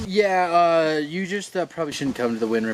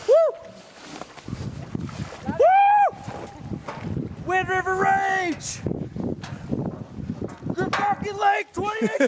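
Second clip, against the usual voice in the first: cheering shout. Real, not acted. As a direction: whoop it out across the lake. Cheering from the boat.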